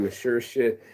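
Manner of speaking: normal talking voice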